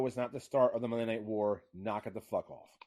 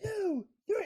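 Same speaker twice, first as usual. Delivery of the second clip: very high-pitched count; high, squeaky-pitched counting; mock pinfall count; much slower than usual. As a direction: imitate a distant count over a blank screen. Mimicking the unseen pinfall count.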